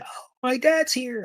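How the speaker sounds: put-on high, slow, childlike "Oh, my dad's here."; imitating excited boy. Retelling the boy greeting his dad, high childlike voice. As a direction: mimic a thrilled little boy.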